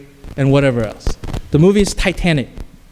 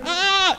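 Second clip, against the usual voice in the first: high, softer held scream; tiny far-off pretend scream, pitched way up; distant mock scream; 12 semitones higher, 5 dB quieter than usual. Audience hears sailor in the film, mock scream. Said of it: "and you hear".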